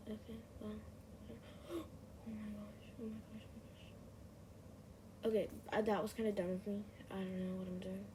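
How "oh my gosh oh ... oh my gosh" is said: breathless gasp, then whispered panic; frightened whisper. Real, not acted. Startled while hiding in game.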